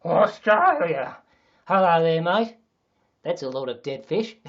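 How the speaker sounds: loud, stretched-out Aussie holler; broad mock Australian, big and loud; Australian accent. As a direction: call out in a hammed-up Australian accent. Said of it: "Let me go into Australian".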